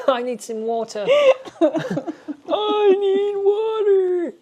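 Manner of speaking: high strained laughing, asking for water; choking laugh. Real, not acted. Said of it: "I'm choking on my hot cacao".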